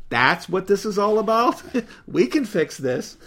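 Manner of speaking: high, amused woman's voice with laughter; pleased it's so simple; chuckling wise woman. Retold or imitated.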